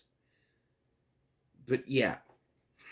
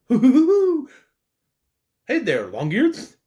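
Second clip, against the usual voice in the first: much higher, louder bouncy Tigger laugh; 9 semitones higher, 8 dB louder than usual. Retold or imitated. Reviewer imitates Tigger's greeting, higher and louder with a laugh. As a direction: hoot it out like Tigger. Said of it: "and Tigger".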